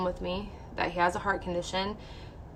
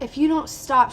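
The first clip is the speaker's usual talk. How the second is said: raised, higher scolding voice; imitated yelling; 6 semitones higher, 6 dB louder than usual. Patient mimics doctor yelling mid-procedure, sharp raised warning. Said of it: "She's, like, yelling at me".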